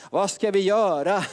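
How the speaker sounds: the crowd pleading, a pleading call; a plea called out. Retold or imitated. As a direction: cry it out to the preacher. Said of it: "De ska ropa".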